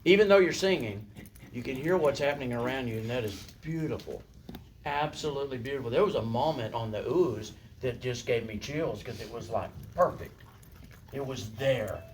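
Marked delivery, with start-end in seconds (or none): 1.5-12.0 softer, lower, tender praise. Real, not acted. Director quietly tells singers it gave her chills. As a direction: hush down and savor the moment. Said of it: "softly praising choir".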